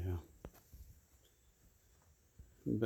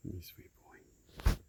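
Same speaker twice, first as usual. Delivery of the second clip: tender whisper; much slower than usual. Real, not acted. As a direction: whisper it slowly, full of fondness. Whispering fondly to donkey.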